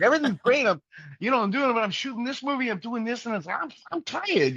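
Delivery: hammed-up impression of a worn-out actor; Joe Pesci voice. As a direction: squeak out a tired movie star's gripes. Mimicking Joe Pesci complaining he's tired.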